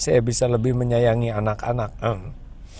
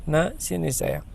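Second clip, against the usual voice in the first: put-on softer, higher, gentle call to her child; tender mother; 6 semitones higher, 4 dB quieter than usual. Preacher voicing the second mother calling her child softly. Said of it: "Yang kedua ngomong gini".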